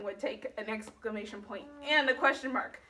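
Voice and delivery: normal talking voice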